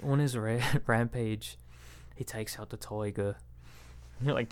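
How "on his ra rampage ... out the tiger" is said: hushed nature-documentary narrator, breaking into a laugh midway; David Attenborough. Acted. Kids narrating back in the twenties, soft documentary-narrator impression. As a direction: softly narrate a wildlife film, pausing between phrases.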